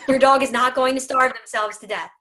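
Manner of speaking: normal talking voice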